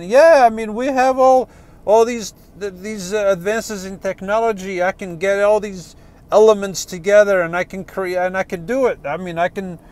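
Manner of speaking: put-on high, loud Yiddish accent boasting; kvetchy old Yiddish accent. Atheist boasting to God.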